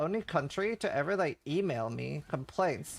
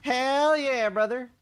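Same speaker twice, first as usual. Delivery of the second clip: Cletus voice; 9 semitones higher, 6 dB louder, slower than usual. Acted. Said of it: "That's the one I usually do".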